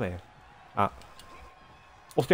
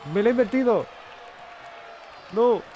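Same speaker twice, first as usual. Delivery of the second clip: frustrated shout; 11 semitones higher than usual. Real, not acted. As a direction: yell in disbelief at the game. Frustración cuando revierten su llave. (grito de frustración)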